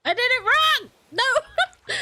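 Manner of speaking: high, loud cry of dismay; real high-pitched panic; panicked. Real, not acted. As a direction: yelp in alarm at a mistake. Mistake in game, panics.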